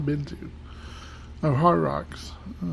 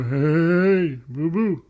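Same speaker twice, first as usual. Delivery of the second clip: silly voice; 4 dB louder, much slower than usual. Acted. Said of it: "Sometimes I go".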